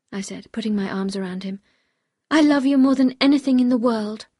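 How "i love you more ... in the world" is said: higher, louder pleading declaration of love; impassioned declaration. Acted. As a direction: plead your love with full voice.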